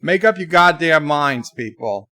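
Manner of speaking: usual speaking voice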